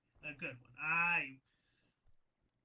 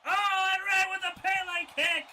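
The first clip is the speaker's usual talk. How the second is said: much higher, loud play-by-play yell; hyped shout; 8 semitones higher, 7 dB louder than usual. Real, not acted. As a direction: scream the big kick to the crowd. Hyped call of a big move.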